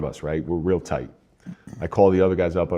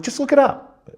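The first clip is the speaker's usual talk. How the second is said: high, louder exasperated retort; frustrated; 5 dB louder than usual. Retold or imitated. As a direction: retell it at the end of your patience, pitch shooting up. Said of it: "And I'm getting frustrated".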